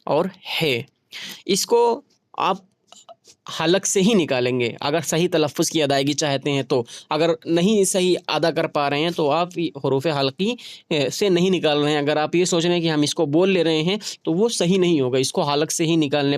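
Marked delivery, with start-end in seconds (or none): none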